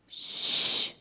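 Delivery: drawn-out "shh" to silence someone; sharp shush. Acted. Crowd silencing the blind beggar, shushing. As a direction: hush a noisy man.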